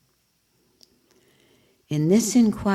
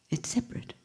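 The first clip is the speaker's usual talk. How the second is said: breathy whisper, much softer; whispered point; 8 semitones higher, 10 dB quieter, faster than usual. Real, not acted. Teacher confiding to students, soft whisper. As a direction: whisper it as a secret truth.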